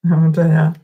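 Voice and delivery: usual speaking voice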